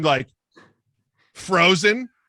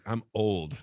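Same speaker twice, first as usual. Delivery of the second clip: lower, softer deadpan reply; low blunt answer; 6 semitones lower, 6 dB quieter than usual. Retold or imitated. Woman answering the friend's age question, low and deadpan. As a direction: say it low and flat. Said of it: "She's like".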